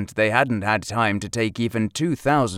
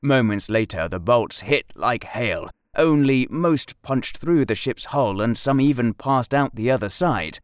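ordinary delivery, nothing marked

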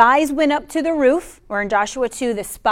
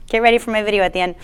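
usual speaking voice

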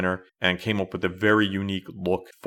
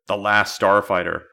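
usual speaking voice

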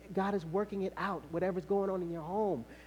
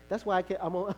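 ordinary talk, nothing marked

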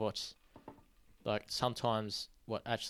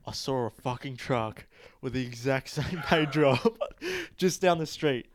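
excited; 4 semitones higher, 6 dB louder than usual. Real, not acted. Excited retelling, laughing.

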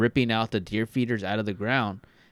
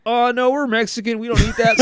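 higher, mock-proper kid voice turning down gumbo; young self; 11 semitones higher, 4 dB louder than usual. Young him refusing the neighbors' gumbo, in a high kid voice. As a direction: play his younger self, higher and prim. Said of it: "And I was like".

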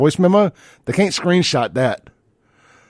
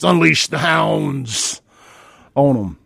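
relishing battle cry; 3 semitones higher, much slower than usual. Acted. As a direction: draw it out like releasing the dogs. Rallying cry wishing patriots were released.

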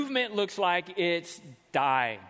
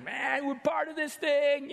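bragging; 9 semitones higher than usual. Acted. Disciples bragging, acted quote.

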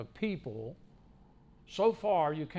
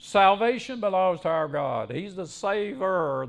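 loud, raised cry of praise; forceful, high pulpit declamation; crying out loudly; 4 semitones higher, 7 dB louder than usual. Acted. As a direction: proclaim it like a great crowd's shout. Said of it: "cried out in a loud voice".

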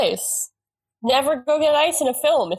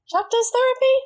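much higher, amazed question; surprised; 12 semitones higher than usual. Retold or imitated. Remembering watching the show, higher surprised question. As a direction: pop up in disbelief at the idea. Retelling own past reaction.

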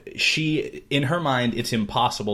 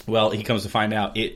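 usual speaking voice

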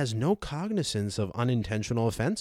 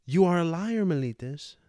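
indignant accusation; 6 semitones higher than usual. A narrator's reading. Socrates accuses Meletus in court.

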